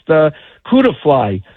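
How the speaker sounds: ordinary delivery, nothing marked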